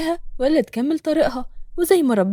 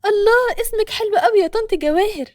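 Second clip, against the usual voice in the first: high, bright exclamation of delight; 9 semitones higher, 3 dB louder than usual. A narrator's reading. Nour admires the lady's name, delighted high voice. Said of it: "ضحكت نور وقالت".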